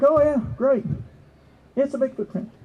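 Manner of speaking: high-pitched, lightly acted acceptance; mock keen reaction. Listener hearing of the juvenile Bigfoot print, mock keen. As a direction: play an easily pleased listener.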